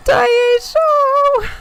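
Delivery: very high, loud fan squeal; excited squeal. Real, not acted. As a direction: squeal the name, overcome. Squeals the wrestler's name.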